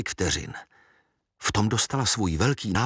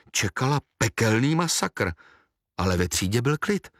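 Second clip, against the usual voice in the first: ordinary delivery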